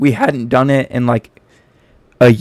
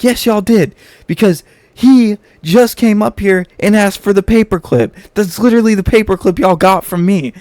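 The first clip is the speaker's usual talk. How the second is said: higher, louder scolding teacher voice; accusing teacher; 7 semitones higher, 4 dB louder than usual. Retold or imitated. Teacher accuses the two boys, higher scolding voice. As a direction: nail them with the proof. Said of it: "She's like".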